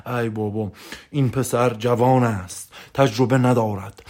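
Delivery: mock old sage. Mimicking an old wise man.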